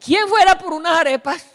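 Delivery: high, loud sing-song hint; mother-in-law's hint. Retold or imitated. Mother-in-law hinting for arepas, high loud coaxing. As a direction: fish for a volunteer sweetly. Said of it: "mi suegra nos invita a la casa y dice".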